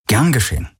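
mock-casual brush-off; feigned casualness. A narrator's reading. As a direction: play it cool, a touch too easy. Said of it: "gespielt locker".

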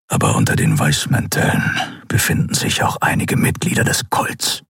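low, barely voiced rasp; growling Skria. A narrator's reading. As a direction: growl the secret low in the throat. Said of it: "knurrte Keru". (tiefes, fast tonloses Knurren)

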